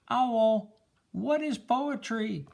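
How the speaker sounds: put-on the boy leans out his window and calls to Owl; boy calling to Owl. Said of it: "he calls to Owl".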